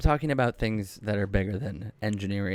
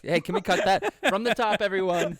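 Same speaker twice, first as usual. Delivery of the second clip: embarrassed high voice; 8 semitones higher than usual. Real, not acted. Embarrassed by toes on camera.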